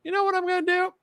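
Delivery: high, soft imitation of an officer; mock officer deciding. Officer deciding what to do to an unarmed man, mock high voice. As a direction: voice his thought in a light, high pitch.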